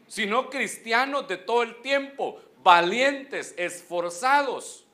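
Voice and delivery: ringing pulpit exhortation, each word driven home; rally the congregation to courage; preaching at full force. Acted. Preacher urging the church to be brave, full preaching.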